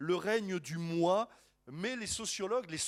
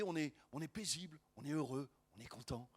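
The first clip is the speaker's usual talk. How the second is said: softer, lower, fading to very quiet; mock serenity; 4 semitones lower, 7 dB quieter, faster than usual. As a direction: put on a peaceful churchgoer's calm. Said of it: "du calmeur, du, du paisibleur".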